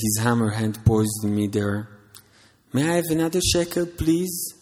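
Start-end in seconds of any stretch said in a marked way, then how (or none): 2.7-4.5 begging. Acted. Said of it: "I begged".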